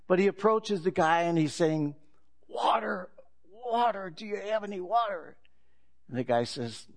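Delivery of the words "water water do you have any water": high, pleading croak of a man dying of thirst; desperate thirsty man. Acted. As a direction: beg for water as if your throat is parched. Parched man begging for water.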